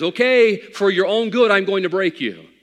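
loud, high firm verdict; stern divine warning. Acted. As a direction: answer the proud sinner firmly from above. God's reply voiced.

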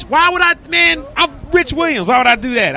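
a real indignant shout that he doesn't need to. Real, not acted. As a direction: shout it, offended. Defending himself, being interrupted.

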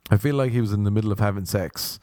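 usual speaking voice

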